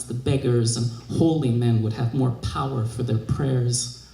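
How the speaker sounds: ordinary delivery, nothing marked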